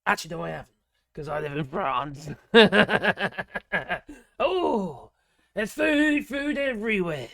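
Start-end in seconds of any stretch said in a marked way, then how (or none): 2.3-4.1 short amused laugh. Real, not acted. Vlogger glad to live in France, amused laugh. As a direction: laugh at your own escape.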